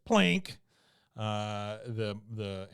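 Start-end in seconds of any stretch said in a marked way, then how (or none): 0.1-0.6 higher, louder plucked sound; mock ukulele plink. Cohost voicing a toddler's ukulele, bright plink sound. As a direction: pluck the word like a string. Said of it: "Caboose says".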